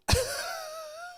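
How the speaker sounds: a very high, strained, half-crying laugh of disbelief; incredulous laugh. Real, not acted. Owner hears the tech call the screaming cat a champ, disbelieving high laugh.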